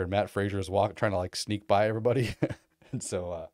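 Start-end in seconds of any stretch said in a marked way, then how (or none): none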